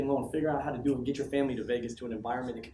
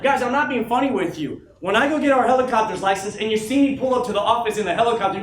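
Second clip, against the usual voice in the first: louder and higher, pushing hard; fired up; 7 semitones higher, 10 dB louder than usual. Real, not acted. Speaker to his team, fired up about the helicopter. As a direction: sell the dream at full volume. Pumping up the room.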